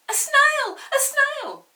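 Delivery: very high, loud shriek of alarm; shrieking teacher. Acted. Teacher spotting a snail in class, high shriek.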